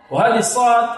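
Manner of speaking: ordinary talk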